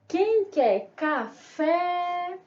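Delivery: singsong hawker selling a word; street vendor's call. Pretend. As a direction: call out your wares, stretching the last word. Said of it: "Vamos vender essa palavra?".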